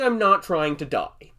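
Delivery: usual speaking voice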